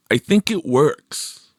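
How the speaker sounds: slow, pleased with himself; Michael voiced. A narrator's reading. Michael boasting to Victor, slow and lightly cocky.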